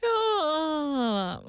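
big drawn-out mock yawn, starting high; fake giant yawn. Host shows a listener's trick for a close friend, giant fake yawn. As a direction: let a huge pretend yawn slide downward. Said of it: "I just fake a giant yawn".

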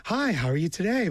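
phone voice. Retold or imitated. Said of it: "a phone voice".

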